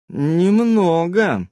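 very slow, loaded «Немного»; slow doubtful «Немного»; odd intonation. A narrator's reading. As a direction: drag «Немного» out with hidden meaning. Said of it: "с непонятной интонацией произнесла".

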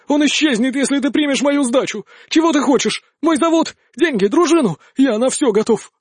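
high-pitched, desperate bargaining; frantic begging. A narrator's reading. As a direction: plead for your life, offering everything. Loser frantically begs for his life.

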